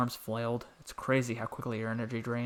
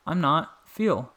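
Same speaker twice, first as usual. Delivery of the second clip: eager insistence; 5 semitones higher, 6 dB louder, much slower than usual. A narrator's reading. Boy insists.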